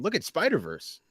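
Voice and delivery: normal talking voice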